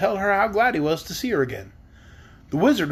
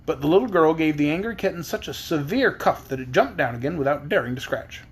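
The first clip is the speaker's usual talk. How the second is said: usual speaking voice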